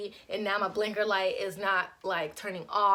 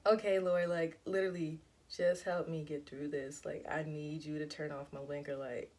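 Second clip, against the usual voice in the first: much softer, lower plea to God; soft prayer; 6 semitones lower, 7 dB quieter than usual. Retold or imitated. Begging God to turn off the blinker, hushed and low. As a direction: pray under your breath, desperate. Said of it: "So I'm like".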